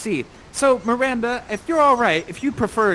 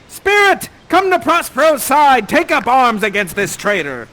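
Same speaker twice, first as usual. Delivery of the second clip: much higher, louder rallying call; commanding Prospero; 6 semitones higher, 9 dB louder than usual. Acted. Prospero calling the spirit against the traitor. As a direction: summon the spirit to arms. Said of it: "Prospero commands spirit".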